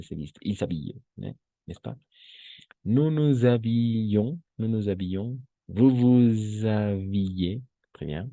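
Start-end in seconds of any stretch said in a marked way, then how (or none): 2.8-7.6 "nous nous habillons" with a linking z sound, drawn out slowly; slow demo of liaison; model liaison. Shown as a demonstration. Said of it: "La liaison".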